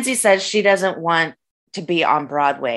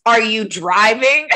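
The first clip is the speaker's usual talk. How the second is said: slow, pointed, slightly louder question; 5 semitones higher, 4 dB louder, slower than usual. Retold or imitated. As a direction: ask it like a loaded accusation. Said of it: "she looks at you and says".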